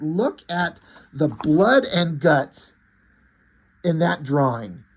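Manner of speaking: ordinary talk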